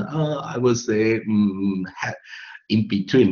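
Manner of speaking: slow imitation of someone groping for words; hesitant filler speech. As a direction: stall with long, drawn-out ums and ahs. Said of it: "我常常看到有些人说". (模仿别人支支吾吾，拖长地说)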